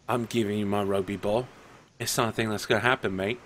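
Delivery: low, soft, lightly put-on voice; a quiet dismissive refusal; defiant Adidas. Adidas refusing the guard, low and soft. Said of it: "he doesn't sound Australian at all".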